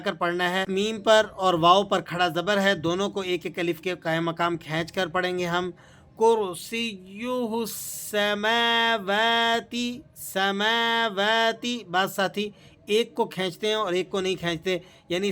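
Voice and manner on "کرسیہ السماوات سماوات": سماوات with ma and wa each one alif long; slow سماوات; both stretched. Shown as a demonstration. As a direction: draw out both vowels of سماوات. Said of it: "میم کو بھی ایک الف کے برابر کھینچنا ہے اور واو کو بھی".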